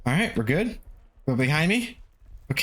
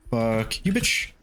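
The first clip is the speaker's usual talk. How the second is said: ordinary talk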